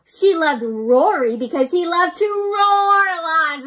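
slow, loud storybook read; reading to children. A narrator's reading. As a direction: read it big for little listeners. Reading picture book aloud.

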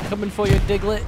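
taunting shout. Real, not acted. Taunting an enemy in game.

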